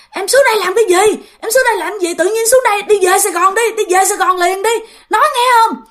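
high-pitched retold telling-off; angry scolding. Boyfriend orders girlfriend back to Sài Gòn, scolding angrily. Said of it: "Nó nói, nó chửi nha".

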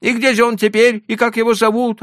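put-on high, pleading old merchant. A narrator's reading. Leiba frets.

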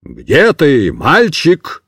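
gendarme calling. A narrator's reading. Sergeant calling into the cart, firm. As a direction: call into the cart. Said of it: "крикнул".

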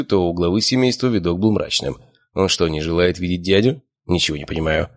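ordinary delivery

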